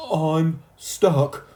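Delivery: high, loud wail; crying out. A narrator's reading. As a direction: cry down from the tail. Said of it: "cried Timothy".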